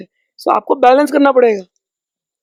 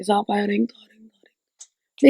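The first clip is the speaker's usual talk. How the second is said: whispered crawling; 3 semitones lower, 7 dB quieter than usual. A narrator's reading. Storyteller whispers the snake crawling out of its hole. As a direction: hush it as the snake creeps out.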